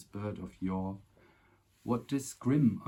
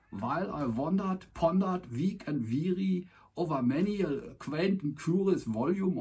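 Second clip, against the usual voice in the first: hammed-up German accent, pitched much higher; 7 semitones higher, 4 dB louder than usual. The Raven redone for viewers in mock German accent. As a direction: recite it in a thick, comic German accent. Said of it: "in the German accent".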